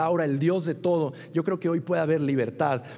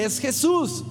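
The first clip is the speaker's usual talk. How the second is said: put-on higher, eager little boy voice; a lightly voiced proud kid; small son showing off; 7 semitones higher, slower than usual. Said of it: "Me dice". (el hijo pequeño enseñando su dibujo, voz aguda y entusiasmada)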